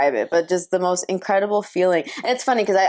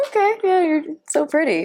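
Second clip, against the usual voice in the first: much higher, sing-song fake gushing; mock fawning; 10 semitones higher than usual. Telling a colleague she's pretty, in a high, mock-gushing voice. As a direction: gush over her with exaggerated sweetness.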